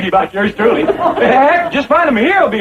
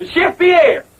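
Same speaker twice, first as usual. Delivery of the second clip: higher-pitched mock-French summons; insistent French-accented call; 6 semitones higher than usual. Host calling the absent chef, insistent fake French accent. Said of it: "I forgot my French accent".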